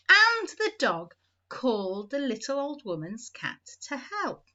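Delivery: plain reading voice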